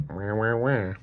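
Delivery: whiny mock wail, babyish and taunting; mock crying wah wah. Mocking people who complain about Boston drivers, whiny mock wah. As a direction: pretend to whine like a crybaby.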